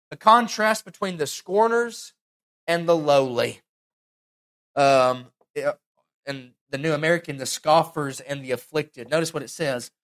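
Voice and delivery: ordinary delivery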